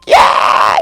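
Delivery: loud, voiceless cheering scream; yelling scream. Retold or imitated. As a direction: scream it with a worn-out voice that barely sounds. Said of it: "I was yelling and screaming like".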